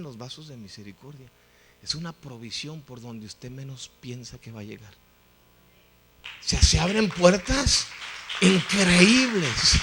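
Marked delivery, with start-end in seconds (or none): 6.2-9.8 loud, slow proclamation; emphatic preaching. Real, not acted. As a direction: drive the point home, slowly and loudly. Preacher drives home his point.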